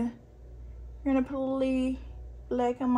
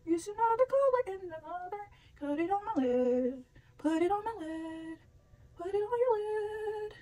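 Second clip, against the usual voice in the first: high, sing-song silly voice; goofy high-pitched chant; 8 semitones higher than usual. Pretend.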